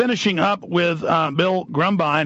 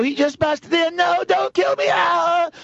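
high, screechy 911 plea; hammed-up panicked victim, pitched way up; mock terrified cry; 12 semitones higher, slower than usual. Imitating women calling nine one one, mock terrified cry. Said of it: "nine one one and go".

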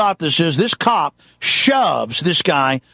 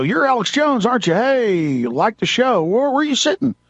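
high, put-on friendly greeting; imitated eager fans; 5 semitones higher than usual. Security stopping a guest they recognise, imitated eager greeting.

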